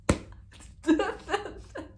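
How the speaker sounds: high, breathy delighted giggle. Real, not acted. Reader reacting to the proposal scene, high giggle. Laugh after acted proposal.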